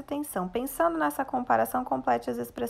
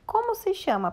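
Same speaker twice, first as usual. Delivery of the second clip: "Como se chama?" with the pitch much higher on "como"; question tone; 9 semitones higher, 3 dB louder than usual. Shown as a demonstration. Teacher showing pupils how to read a question. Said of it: "usar um tom de pergunta".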